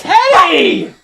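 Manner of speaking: loud, high call; calling Teddy sharply; shouted name. Real, not acted. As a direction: yell the dog's name. Calling the dog.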